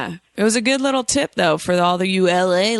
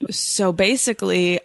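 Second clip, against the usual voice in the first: normal talking voice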